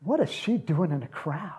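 hushed unvoiced whisper. Real, not acted. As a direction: whisper it like a scandal. Incredulous aside.

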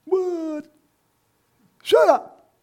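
high, loud mock outrage; outraged scolding. Acts out indignant adults scolding kids.

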